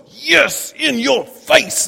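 a gloating taunt, pitched high and drawn out; mock victory jeer. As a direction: rub it in, triumphant and slow.

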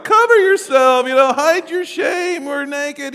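high, loud panicked cry of shame; mock panic at being caught bare; panicked Adam. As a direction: squeal it like Adam caught naked.

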